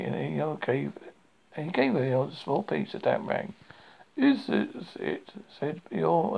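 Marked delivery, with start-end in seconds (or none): 4.2-5.3 slow, higher surprised question; a little surprised. A narrator's reading. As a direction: draw it out in mild surprise. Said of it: "a little surprised".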